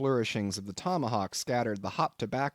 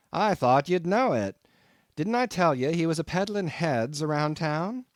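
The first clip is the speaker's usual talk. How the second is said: 1 landlord; 5 semitones higher, 4 dB louder than usual. A narrator's reading. Landlord voiced, loud reply.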